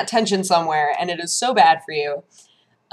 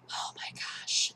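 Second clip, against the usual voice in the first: dismayed whisper; 16 dB quieter, slower than usual. Real, not acted. Dismayed aside.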